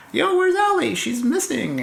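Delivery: 높은 목소리로 걱정하는 흉내; imitating concerned callers. Voicing worried bandmates of missing person.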